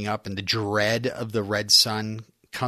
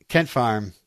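usual speaking voice

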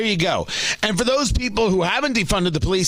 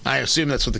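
usual speaking voice

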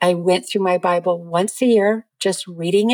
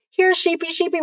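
high singsong here sheepy; calling sheep; 10 semitones higher, faster than usual. Retold or imitated. To the grazing sheep, high coaxing singsong call.

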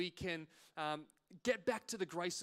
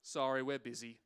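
lightly voiced checkout lady, lower pitch; 7 semitones lower than usual. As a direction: brush off a customer in a low voice. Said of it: "this checkout lady just goes".

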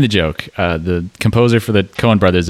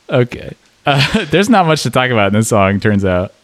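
normal talking voice

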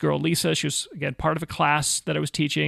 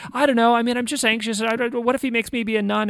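higher, tumbling mock-anxious voice; imitated anxiety; 7 semitones higher, 3 dB louder than usual. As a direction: let the worries pour out as her, pitched up. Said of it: "all of these anxieties and fears".

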